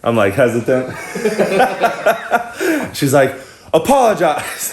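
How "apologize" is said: higher-pitched, drawn-out command; mock-stern order, pitched up; imitated demanding girl. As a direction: demand it like an offended woman. Imitating the girl's demand again.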